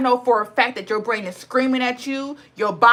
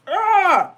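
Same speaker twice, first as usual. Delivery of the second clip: tearful groan; 7 semitones higher, 5 dB louder than usual. Real, not acted. Overwhelmed, close to tears.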